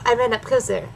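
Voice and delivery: ordinary delivery, nothing marked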